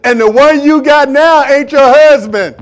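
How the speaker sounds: loud, high, slow proclamation; preached revelation. Acted. Preacher voicing Jesus exposing the woman, loud and high. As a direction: drive it home loud and slow. Jesus voiced, louder climax.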